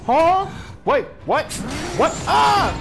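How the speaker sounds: frantic high yelling at the screen; shrieking, losing it during the chase; panicked screaming. Real, not acted. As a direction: scream like you're being chased, pure panic.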